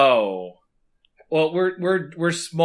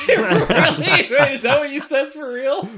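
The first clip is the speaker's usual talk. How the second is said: high, laughing surprise; laughing disbelief; 8 semitones higher, slower than usual. Real, not acted. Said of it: "laughing disbelief".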